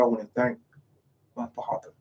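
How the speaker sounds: a hushed, rarely heard thank-you to a father; rare quiet thanks. Retold or imitated. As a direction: let the words almost vanish, barely breathed. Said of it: "very rarely you hear".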